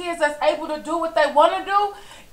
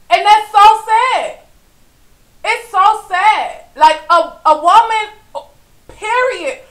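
louder, higher, voice cracking with tears; real tearful outburst; 5 semitones higher, 6 dB louder than usual. Real, not acted. Scolding absent fathers to viewers, loud and tearful. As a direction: near sobbing as she calls men out.

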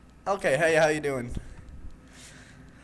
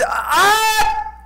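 sudden high loud scream; startled scream; 8 dB louder than usual. Real, not acted. Gamer nearly caught by animatronic, startled scream. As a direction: shriek at the near miss.

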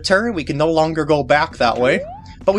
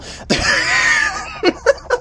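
high shrieking laugh. Real, not acted. Laughs after 'not stressful' joke.

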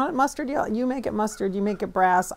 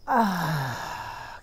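deep sigh; 3 semitones lower than usual. Real, not acted. Settling in to breathe.

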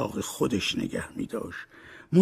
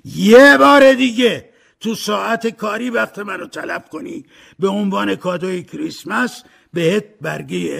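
much louder and higher threatening yell; furious shout; 9 semitones higher, 11 dB louder than usual. A narrator's reading. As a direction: bellow a threat at the clerk. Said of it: "اسکروچ فریاد زد".

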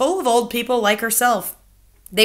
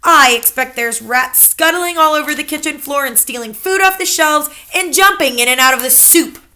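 higher, louder grandmother voice; accusing grandmother; 6 semitones higher, 7 dB louder than usual. Acted. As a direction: pin the blame on him. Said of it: "my grandmother said".

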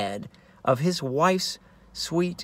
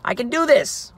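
sudden confidence; 8 semitones higher than usual. Acted. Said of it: "he realizes".

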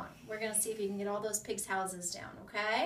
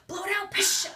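high, loud coaching push; loud cheering; 9 semitones higher, 11 dB louder than usual. Shown as a demonstration. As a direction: drive the kid to blow hard. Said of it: "coach urges forceful exhale".

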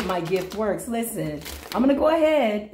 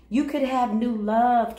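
ordinary talk, nothing marked

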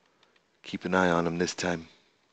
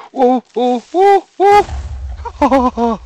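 much higher, much louder panicked whoa; startled yell; 11 dB louder, much slower than usual. Real, not acted. Creeper explodes beside the gamer, panicked yell. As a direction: yelp as the creeper blows.

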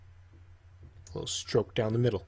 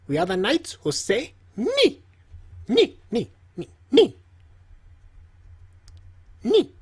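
rising, ever louder ni chant; knights who say ni; 9 semitones higher, 4 dB louder than usual. Retold or imitated. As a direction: build the ni up higher and louder each time. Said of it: "Do you remember this character?".

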